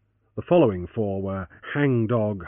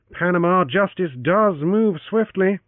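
wry soliloquy; 8 semitones higher, 4 dB louder, slower than usual. Acted. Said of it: "in a matter of soliloquy".

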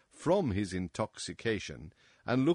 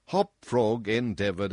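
plain reading voice

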